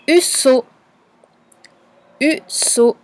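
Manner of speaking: "Husso" enunciated for spelling; dictated syllables. Shown as a demonstration. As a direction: dictate "Husso" as u, then sso. Teacher dictating syllables twice.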